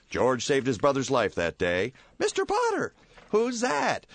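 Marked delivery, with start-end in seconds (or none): 2.2-4.1 put-on much higher curious kid voice; young boy. Young boy points out Potter, high and curious. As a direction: ask it like an excited child.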